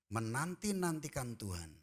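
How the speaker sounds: ordinary delivery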